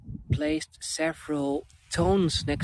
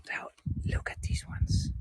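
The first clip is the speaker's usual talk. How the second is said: whisper; 7 dB quieter than usual. Real, not acted. Pointing out delicate flowers.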